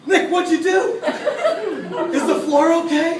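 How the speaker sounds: high, loud fussing parent; hammed-up alarmed consoling; mock parental concern. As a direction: fuss loudly over the floor in an exaggerated high voice. Mock fussing over the floor.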